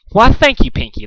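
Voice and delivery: high, preening thanks; smug pride. A narrator's reading. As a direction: savour your victory. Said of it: "said with smug pride".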